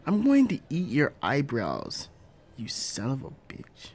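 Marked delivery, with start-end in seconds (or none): 2.6-3.9 breathy hissed curse; whispered insult. Acted. Parent insulting spouse, whispered. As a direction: mutter it under your breath.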